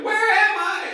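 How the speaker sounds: very high, loud, put-on befuddled old lady; hammed-up fake confusion; confused grandma act. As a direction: play the lost old woman on purpose. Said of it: "she starts doing the".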